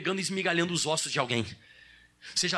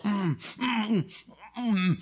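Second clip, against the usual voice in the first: lion's shut mouth; slower than usual. Retold or imitated. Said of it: "olha como é que ficava o leão".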